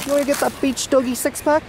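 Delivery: very high, sing-song doggy voice. Real, not acted. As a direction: coo at him like a puppy. Baby talk to the dog.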